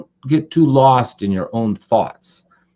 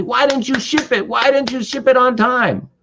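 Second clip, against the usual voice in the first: high, exasperated questioning; mock angry demand; 13 semitones higher than usual. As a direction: act out blaming someone for a late shipment. Angry at late shipment.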